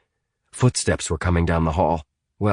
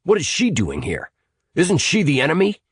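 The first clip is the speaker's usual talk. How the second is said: unfriendly; 10 semitones higher than usual. A narrator's reading. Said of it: "stared at Sinead with unfriendly eyes".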